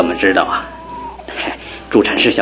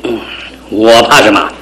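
usual speaking voice

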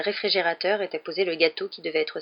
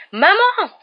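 shrill, much higher screamed call; 14 semitones higher, 11 dB louder than usual. A narrator's reading. Dudley fleeing to the house, screaming for his mother. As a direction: shriek for your mother. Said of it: "hurla Dudley".

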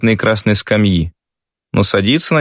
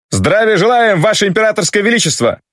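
troops roaring in unison; 11 semitones higher than usual. A narrator's reading. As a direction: roar the greeting as one. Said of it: "Громыхнул строй в одну глотку".

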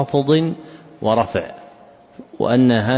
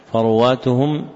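usual speaking voice

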